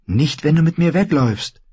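put-on high, insistent girl's voice; high-pitched, eager persuasion; urging girl. A narrator's reading. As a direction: coax him in a high young voice. Girl urging boy to flee.

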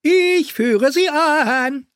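high, slow jester voice; sing-song court fool. Acted. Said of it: "Flickenfratz sprang auf".

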